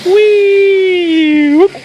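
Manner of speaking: high, loud, drawn-out whee; excited whee. Real, not acted. Baby going down slide.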